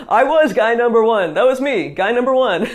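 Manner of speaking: real laugh through the admission; laughing confession. Real, not acted. Teacher admitting to viewers she was guy one, laughing. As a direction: chuckle at your own past self.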